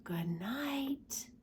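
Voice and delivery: soft breath-only good night; whisper. A narrator's reading. Narrating the dinosaurs' goodnight, a gentle whisper. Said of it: "they whisper".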